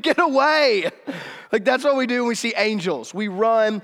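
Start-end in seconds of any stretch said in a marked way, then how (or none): 0.0-0.9 high, loud panicked cry; frightened yell. Acted. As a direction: shout it as if fending off a terror. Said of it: "we are afraid".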